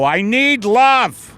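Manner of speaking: loud, high, slow plea for love; yelling into the street. Retold or imitated. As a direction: belt it out to the empty street. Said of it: "I'll just go out into the street and I go".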